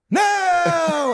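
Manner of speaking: very high, loud wail; hammed-up shriek of denial; panicked mock scream. Man running for his life, mock panicked scream.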